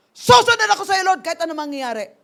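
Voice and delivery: high, very loud declaration of faith; angry vow. Retold or imitated. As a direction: burst out with the vow, furious. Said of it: "yung galit na galit ka".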